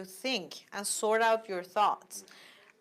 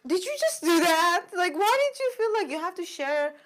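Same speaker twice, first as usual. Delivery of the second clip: higher and louder, stunned disbelief; shocked confronting; 7 semitones higher, 7 dB louder than usual. Retold or imitated. Confronting the coworker who told everyone, shocked and louder. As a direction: demand why she shared it, voice up in shock. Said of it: "And then I'm like".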